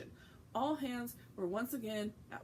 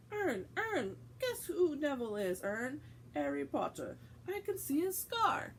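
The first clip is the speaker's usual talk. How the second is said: excited; 5 semitones higher, 3 dB louder than usual. Acted. Stan excited about Harry.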